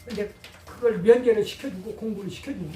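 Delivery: ordinary delivery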